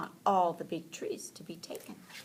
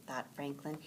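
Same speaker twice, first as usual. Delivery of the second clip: ordinary delivery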